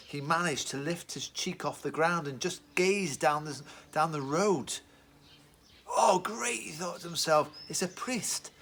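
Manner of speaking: expressive, rising and falling story voice; storytelling to children. A narrator's reading. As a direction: Bible story read aloud with feeling. Dramatic Bible story told to children.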